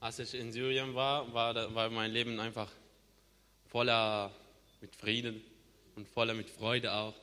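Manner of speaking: normal talking voice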